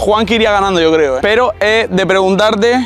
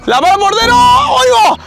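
excited commentary; 13 semitones higher than usual. Real, not acted. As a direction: call the shots like a live match. Commentating friend's penalties.

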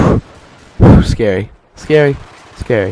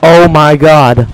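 loud, higher, drawn-out exclamation; stunned outcry; 4 semitones higher, 7 dB louder, slower than usual. Real, not acted. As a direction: draw it out loudly, appalled. Stunned after being killed.

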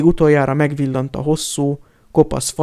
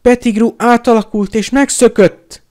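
urgent call; 8 semitones higher, 6 dB louder than usual. A narrator's reading. Harry calls to Sirius.

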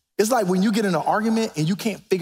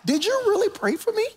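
amazed question; 12 semitones higher than usual. Retold or imitated. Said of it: "And I FaceTime".

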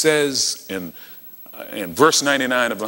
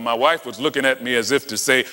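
ordinary talk

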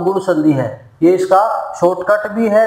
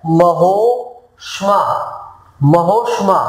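slow model word; slower than usual. Shown as a demonstration. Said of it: "teacher models word".

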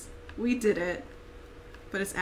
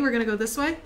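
normal talking voice

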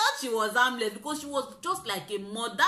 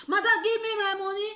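retold pleading shout; 9 semitones higher than usual. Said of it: "we were speaking broken".

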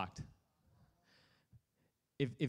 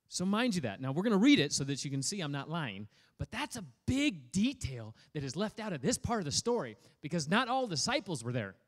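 ordinary talk

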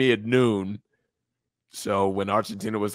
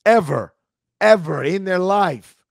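louder, higher emphatic correction; 6 semitones higher, 7 dB louder than usual. Real, not acted. Correcting his co-host that the city never won, emphatic. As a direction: hammer home the word Ever.

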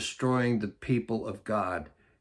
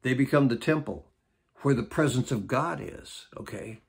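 ordinary talk

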